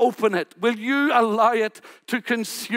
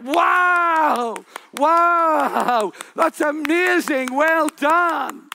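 impressed crowd cheer; 5 semitones higher, much slower than usual. Retold or imitated. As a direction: cheer the actor on like a delighted guest. Party guests praise the recitation.

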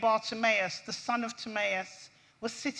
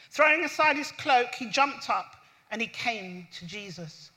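normal talking voice

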